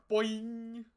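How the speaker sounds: cartoon boing. Reactor mimics bounce noise.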